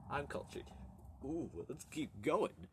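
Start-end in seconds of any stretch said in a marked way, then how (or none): none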